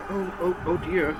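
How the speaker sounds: hushed, worried nurse; acted nervous little fretting. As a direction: murmur it softly in unease. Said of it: "look at each other nervously".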